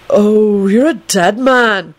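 slow, drawn-out knowing tease. A narrator's reading. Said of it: "he added knowingly".

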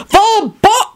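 high, forceful spell cry; shout. A narrator's reading. Arthur fires off his wand, shouting. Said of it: "snatched out his wand and shouted".

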